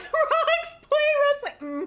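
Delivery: high, loud, drawn-out squeal. Acted. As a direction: shriek it in delight. Squealing at joke.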